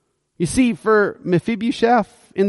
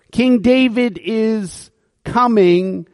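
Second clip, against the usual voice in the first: usual speaking voice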